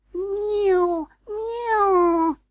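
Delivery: very high, drawn-out meow; cat's mew. A narrator's reading. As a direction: mew shrilly like an interrupting cat. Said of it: "interrupted Simpkin".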